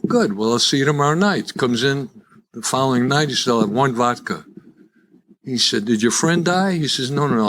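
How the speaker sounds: ordinary talk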